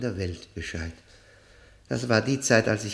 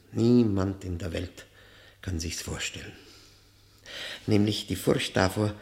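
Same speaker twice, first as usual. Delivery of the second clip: ordinary talk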